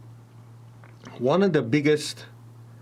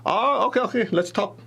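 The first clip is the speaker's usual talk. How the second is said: lit up; 8 semitones higher than usual. Retold or imitated. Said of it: "You see him light up and go".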